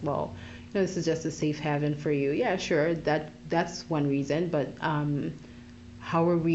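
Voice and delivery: normal talking voice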